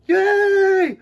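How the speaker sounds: high, loud cheer; celebrating yay. Real, not acted. Host cheering at story time opening. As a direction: shout it for joy. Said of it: "That's me celebrating".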